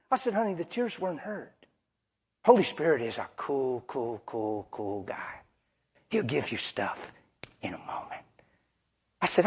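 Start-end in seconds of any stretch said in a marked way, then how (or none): 0.3-8.2 calm her down tenderly; gentle reassurance. Retold or imitated.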